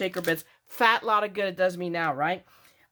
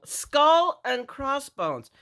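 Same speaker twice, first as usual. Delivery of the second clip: higher, slow, dramatic announcement of "Skull and crossbones"; a playful showcase of "Skull and crossbones"; drawn-out reveal; 7 semitones higher, 3 dB louder, much slower than usual. Real, not acted. Showing off Halloween shaker bits.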